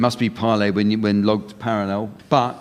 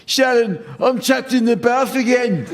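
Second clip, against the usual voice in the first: higher put-on Brummie accent; 11 semitones higher than usual. Shown as a demonstration. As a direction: put on a broad Birmingham accent, pitched up. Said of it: "And here in Birmingham".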